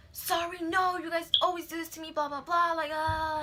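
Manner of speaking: high-pitched imitation of a nonstop rant; put-on high complaining tirade; mock yelling. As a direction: mimic an irate customer, high and relentless. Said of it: "yelling at me nonstop".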